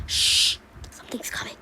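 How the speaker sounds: sharp shush, then a whisper; hushed warning. Acted. Papa Pine hears someone coming, whispers a warning.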